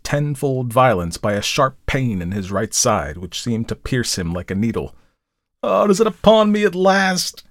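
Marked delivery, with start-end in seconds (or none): 5.6-7.4 high, dread-filled outcry; fear-stricken groan. A narrator's reading. Sick rider feels the fatal pain strike, groaning in fear. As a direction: groan it out in terror, pitched high. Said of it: "groaned the fear-stricken man".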